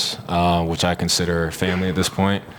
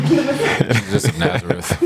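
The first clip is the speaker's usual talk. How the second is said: laughing; 9 semitones higher, slower than usual. Real, not acted. Joke name introduction.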